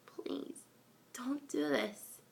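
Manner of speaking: stone-frozen filly begging quietly, soft; murmured plea. Acted. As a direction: barely get the words out, begging. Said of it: "Babsy murmured".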